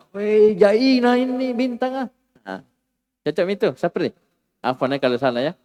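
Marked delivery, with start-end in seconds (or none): 0.1-2.1 high, slow awed cry at the stars; amazed exclamation. Retold or imitated. Teacher acting a student's amazement. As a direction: gush in wonder at the sky.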